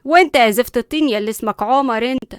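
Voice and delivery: loud, contemptuous summons; scolding call. A narrator's reading. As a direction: call the boy out like a cross granny. Grandmother calls out grandson rudely.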